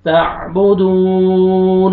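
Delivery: slow drawn-out chanted recitation; Quranic recitation. Shown as a demonstration. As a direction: chant it slowly, stretching the vowels. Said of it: "Quran recitation demo".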